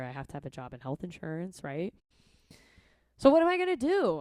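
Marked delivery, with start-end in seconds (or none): 3.2-4.2 mock panic. Real, not acted. Rhetorical panic question.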